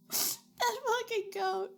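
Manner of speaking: slow, high, laugh-crying curse. Real, not acted. Streamer cursing the game goat while crying with laughter. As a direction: wail it through laughter.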